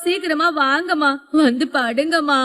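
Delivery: put-on small girl's high pleading wail; a scared little girl begging her mother; crying child. A narrator's reading. Little daughter cries to her dazed mother, high and pleading. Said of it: "என சொல்லிக்கொண்டே கதறினாள்".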